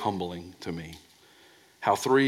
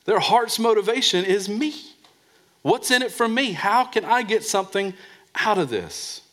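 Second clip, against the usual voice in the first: much higher, louder mocking tone; high mock me-first whine; mock selfish voice; 10 semitones higher, 4 dB louder than usual. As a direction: voice the self-seeker.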